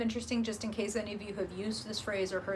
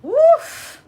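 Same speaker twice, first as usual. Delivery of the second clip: loud high woof; 17 dB louder than usual. Real, not acted. Reaction to a card.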